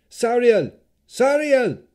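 high, very loud desperate cry of a name; shrill scream, searching for someone lost; shrill, urgent screams. A narrator's reading. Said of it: "His screams became shrill with increasing urgency".

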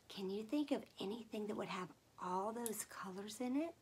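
ordinary talk, nothing marked

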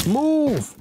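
frantic yell to get out of the way; panicked shout. Real, not acted. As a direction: shout it out in alarm. Yelling at mob blocking way.

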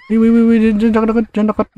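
high frightened jangan dekat; begging the ghost to stay back, high; scared pleading. Real, not acted. Gamer begs the Pontianak not to come near, high and scared.

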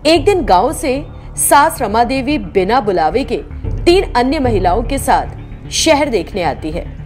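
usual speaking voice

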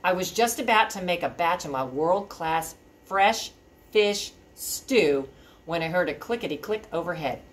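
ordinary delivery, nothing marked

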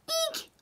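sharp gasp, then high eek; delighted high-pitched squeal after a gasp. Acted. Owner thrilled at getting the horse.

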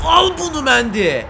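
loud, high gloating; triumphant taunt. Real, not acted. Gamer gloating at the beaten goalkeeper, loud. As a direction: rub it in the keeper's face. Taunting keeper after goal.

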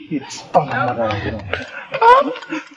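slow, soft, crying plea; tearful. Acted. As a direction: break down as you insist on her. Rejected suitor pleading to his mother.

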